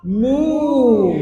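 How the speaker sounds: loud, high mooing cow. Acted. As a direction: moo like a cow for a kid. Teacher imitates cow for child.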